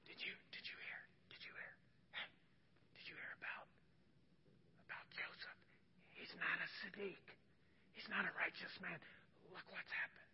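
hushed, secretive whisper between neighbours; gossiping whisper. Acted. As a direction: spread the rumour behind a hand. Voicing gossiping townspeople.

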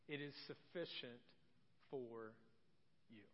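low, soft, unhurried reassurance; gentle divine refusal. Retold or imitated. God gently telling Paul his grace is enough, soft and low. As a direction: say no tenderly, like a loving father.

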